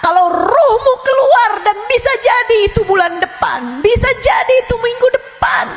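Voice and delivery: loud, high warning sermon; fiery preaching. Acted. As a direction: thunder a warning about the grave. Preaching at full force about death. (ceramah berapi-api dengan suara tinggi dan keras)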